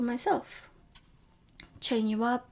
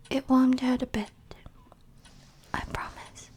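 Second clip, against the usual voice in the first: ordinary talk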